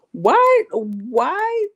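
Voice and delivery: high, loud baffled why; exasperated disbelief; incredulous. Real, not acted. Reacting to Nicki's quote.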